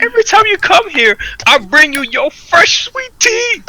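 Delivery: high, loud, stretched-out comic rant; mock indignant outburst. Imagined telling the customer off.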